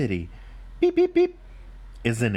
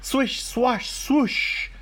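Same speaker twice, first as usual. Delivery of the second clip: high, hissy swish of brushes; street sweeper swishing; 11 semitones higher, much slower than usual. Acted. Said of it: "went a big street sweeper".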